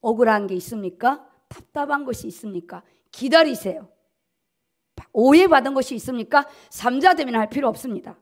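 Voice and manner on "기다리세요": ordinary talk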